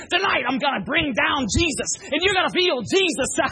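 high, loud preacher holler ending in a heaved gasp; breathless raspy preacher. Retold or imitated. Imitating the preacher who couldn't breathe, raspy high-pitched shout. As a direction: shout like a preacher running out of air. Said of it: "get this weird thing where he couldn't breathe".

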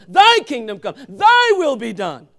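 loud, slow, drawn-out proclamation; proclaimed prayer. Real, not acted. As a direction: ring out the Lord's Prayer to the congregation. Preacher proclaims the prayer loudly.

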